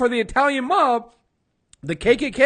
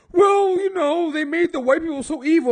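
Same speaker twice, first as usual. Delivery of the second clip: mock Academy voter; 8 semitones higher than usual. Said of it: "the Academy voters had was".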